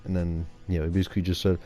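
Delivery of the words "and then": plain reading voice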